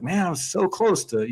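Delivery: high, wistful whine on close; rueful complaint. Retold or imitated. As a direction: moan about nearly winning. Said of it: "I was like".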